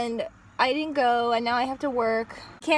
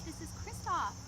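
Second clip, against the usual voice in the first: put-on soft, gentle princess voice talking to children; storytelling queen speaking softly to kids; Elsa character voice; 5 semitones higher, 10 dB quieter than usual. Plays Elsa at a kids' party.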